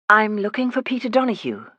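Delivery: light posh Edinburgh lilt; Edinburgh accent. Acted. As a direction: nurse at the door in a refined Edinburgh accent. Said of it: "I do a passable Edinburgh accent".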